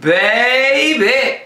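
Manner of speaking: high, bouncy hype-man delivery; hype host voice. Acted. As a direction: pump up the crowd. Recording the video intro.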